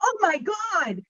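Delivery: giddy shock at a surprise message; stunned excitement. Retold or imitated. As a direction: squeal it out in disbelief. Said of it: "I'm like".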